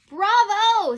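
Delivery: put-on a high, loud little girl's bravo; cheering girl. Clementine cheers George in the story, high and loud. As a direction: cheer it like a proud child. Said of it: "said Clementine".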